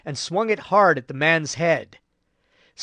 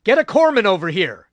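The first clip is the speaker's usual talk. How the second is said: urgent order; 5 semitones higher, 6 dB louder than usual. A narrator's reading.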